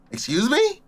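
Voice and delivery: high, questioning "excuse me"; voice out of nowhere. Retold or imitated. A voice breaks into his daze, high questioning call. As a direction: lift it high with a rising question. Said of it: "You just hear a voice say".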